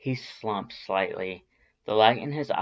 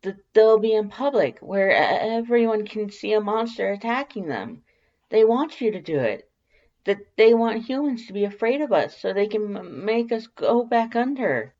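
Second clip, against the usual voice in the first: high, loud, stammering outrage; indignant Alphys; 12 semitones higher, 6 dB louder than usual. A narrator's reading. Alphys argues with Sans, high loud stammering outrage. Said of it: "Alphys is in high dudgeon".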